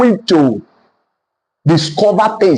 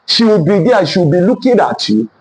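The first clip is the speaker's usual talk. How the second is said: usual speaking voice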